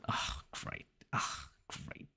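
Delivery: breathy, deadpan "great"; whispered sarcasm. Real, not acted. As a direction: mutter it under your breath, fed up. PC lags out, sarcastic.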